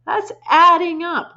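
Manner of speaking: put-on high, slow, pleading boy's cry; frightened cry. A narrator's reading. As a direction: protest in panic. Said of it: "Wilfred cried".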